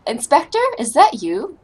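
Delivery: usual speaking voice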